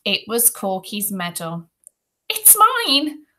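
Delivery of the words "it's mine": very high, small squeaky voice; thin, high-pitched claim; faint boy's protest. A narrator's reading. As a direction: pipe it up feebly as a little boy. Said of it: "Ruskin said faintly".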